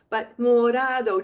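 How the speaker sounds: morado with a light tapped R; gentle tap. Shown as a demonstration. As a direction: tap the tongue once for the R in morado. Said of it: "Just a gentle tap of your tongue".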